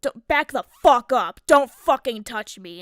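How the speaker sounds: loud, furious shout to back off; screamed warning. Retold or imitated. Mom screaming at the man to back off. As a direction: yell to make him back away.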